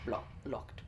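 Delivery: breathy whisper of the word locked. Real, not acted. Gamer sneaking past a guarded door, whispering. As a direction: whisper it so no one hears.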